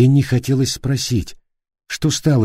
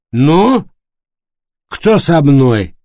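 put-on drunk challenge; 5 semitones higher, 5 dB louder, much slower than usual. A narrator's reading. Пьяный вызов.

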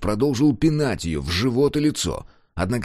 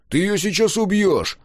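much higher, louder alarmed yell; alarmed shout; 11 semitones higher, 4 dB louder than usual. A narrator's reading. Klaus yells that Scar will kill her. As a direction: cry out in alarm.

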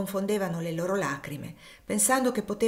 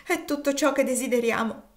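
tearful plea; 6 semitones higher, 4 dB louder than usual. A narrator's reading. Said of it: "esclamò Elisa singhiozzando".

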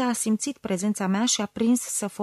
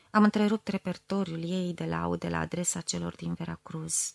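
ordinary delivery

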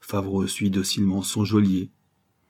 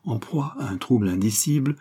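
plain reading voice

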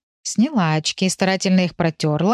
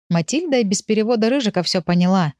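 ordinary delivery, nothing marked

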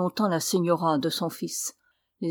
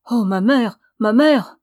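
loud, higher-pitched cry of dismay; appalled outcry; 3 semitones higher, 9 dB louder than usual. A narrator's reading. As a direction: cry out in horror, rising on the repeat. Son appalled by mother's harsh words.

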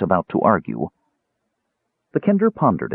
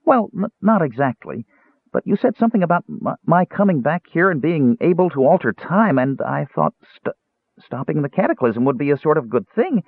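high, stammering little kender voice; high-pitched, hesitant excuse-making; nervous kender; 8 semitones higher than usual. Acted. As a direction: explain yourself nervously, tripping on words.

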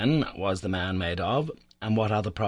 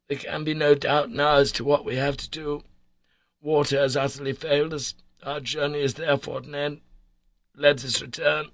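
higher, insistent plea; earnest appeal; 6 semitones higher than usual. A narrator's reading. Harry telling his uncle they must turn back, earnest appeal. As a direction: urge it firmly, pitched up. Narrator voices Harry urging retreat.

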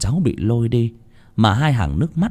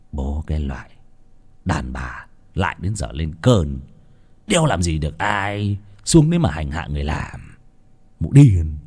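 put-on contemptuous old master; 6 semitones lower than usual. Master Chánh mutters contempt at his wife, low and sneering. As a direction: sneer at the mad wife under your breath.

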